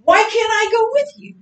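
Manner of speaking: sudden high, loud outburst; blurting out. Retold or imitated. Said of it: "he just blurts out".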